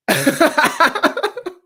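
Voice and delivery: a loud high-pitched burst of laughter. Real, not acted. Streamer laughing hard at his bar fantasy, high and loud. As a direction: squeal with laughter at your own joke.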